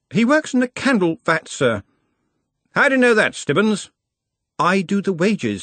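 the nervous clerk edging a question out; fretful junior, pitched well up; anxious young wizard. Acted. Ponder voiced in audiobook.

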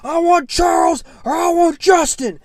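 high, loud fighter's challenge; imitated screamed callout. Fighter calling out his next opponent, screaming. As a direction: yell it like a pumped-up winner. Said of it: "screaming at the top of his lungs".

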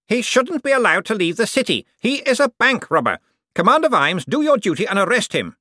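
pompous man pressing for an arrest; Cosmo, indignant. A narrator's reading.

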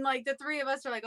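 ordinary delivery

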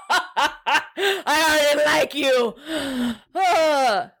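slow, laughing approval; amused chuckle. Acted. Commander charmed by the toast, laughing. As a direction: chuckle it out warmly.

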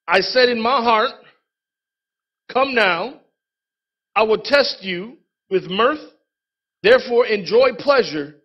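preacher reading the Bible aloud; scripture reading. Acted. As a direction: read the verses with weight.

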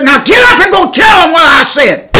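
loud booming command; commanding shout. Retold or imitated. The Lord sending the preacher out, shouted command. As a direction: order it out as God.

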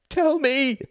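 very high, loud desperate demand; agonized cry. A narrator's reading. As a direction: cry it out in agony. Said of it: "Her voice was agonized".

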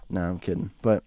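usual speaking voice

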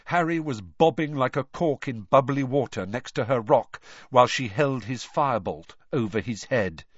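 ordinary delivery

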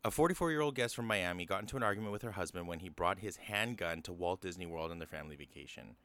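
ordinary talk, nothing marked

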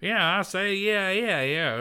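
high-pitched Kentucky twang, imitating; high Kentucky accent. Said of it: "a high accent".

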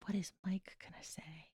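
unvoiced worried whisper. Real, not acted. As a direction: whisper it aside to yourself. Secret aside during guessing game.

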